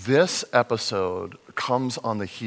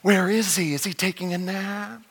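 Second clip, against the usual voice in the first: higher, sarcastic taunting; sarcastic mock concern, pitched up; 6 semitones higher than usual. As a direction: needle them about their sleeping god. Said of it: "mocking the prophets of Baal".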